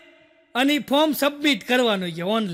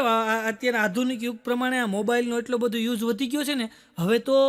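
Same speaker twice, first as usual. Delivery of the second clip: normal talking voice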